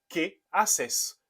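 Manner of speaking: "que haces" slowly, stress on "que"; Spanish question. Shown as a demonstration. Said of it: "dans la question".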